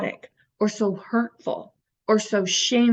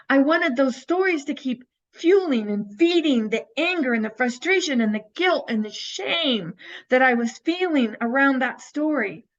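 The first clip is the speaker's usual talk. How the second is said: usual speaking voice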